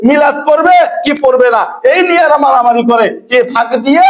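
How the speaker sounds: fiery preaching. Acted. Preaching fervently to congregation.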